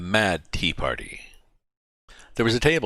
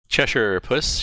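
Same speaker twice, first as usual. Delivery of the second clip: a shy, careful address to the cat; timidly calling up to the grinning cat; 6 semitones higher, 5 dB louder, slower than usual. A narrator's reading. Alice timidly addressing the Cheshire Cat, shy and careful. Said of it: "she began rather timidly".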